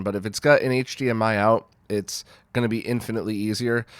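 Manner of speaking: usual speaking voice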